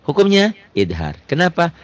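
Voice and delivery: normal talking voice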